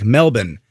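Melbourne as a reduced "MEL-bn"; local pronunciation. Shown as a demonstration. Co-host showing how you say it, Melbourne as "MEL-bun". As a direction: drop the R in Melbourne, swallow the ending. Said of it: "That's the way you say it".

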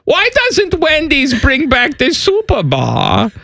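high, slow fake German accent; chatty German tourist voice, high and slow. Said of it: "Your German accent".

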